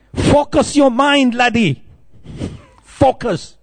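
high, slow Scottish instruction; Scottish accent. Retold or imitated. Scottish monk drills devotee on japa, Scottish accent. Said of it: "very good at Scottish accent".

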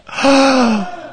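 high, long gasp for others to copy; demonstrated alarm gasp. Shown as a demonstration. Getting listeners to play the onlookers, high alarmed gasp. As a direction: lead the group in a shocked gasp. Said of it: "Can we all say that?".

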